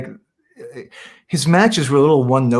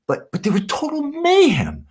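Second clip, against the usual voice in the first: excited. Real, not acted. Excited about the Sheik's matches.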